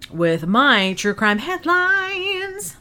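slow, high, drawn-out announcement; dramatic announcer. Acted. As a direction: stretch it like a show host.

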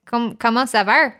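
put-on light Québécois accent; mock French Canadian. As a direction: tease in a Quebec French voice.

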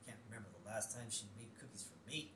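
very soft, quick, low muttering; upset grumbling. Acted. Husband upset about wife's cookies, muttered grumble. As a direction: grumble it under the breath. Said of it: "George Bush is upset here".

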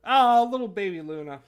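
high, loud doting coo. Real, not acted. Host fussing over the cat Luna, cooing high. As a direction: gush over a tiny pet.

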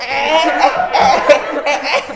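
high laughing comic stammer; Sri Mulat comedian imitation. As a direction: play it like an old comedy troupe. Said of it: "ini gue jadi ingat kayak Sri Mulat gitu".

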